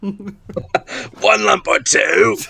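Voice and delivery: high, drawn-out British tea offer; slow, high mock British accent. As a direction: ham it up in British, laughing. Mock British tea banter continues.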